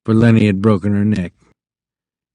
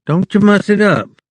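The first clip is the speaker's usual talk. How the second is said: high fussy warning from the wife; a vain young wife fretting over her hair; wife scolding him lightly about her hair; 9 semitones higher than usual. A narrator's reading. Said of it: "she said".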